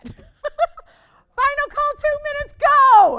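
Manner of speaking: high, loud laughing shout, slow on final call. Real, not acted. Host calling final bid to viewers, laughing loud and high. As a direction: burst out laughing as you call it. Auction host's last call.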